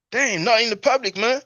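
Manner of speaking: high, loud damn not in the public; squeaky mocking repeat; mock indignant echo. Quoting a viewer mocking the gang leader's line, high and loud. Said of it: "And Sarah's like".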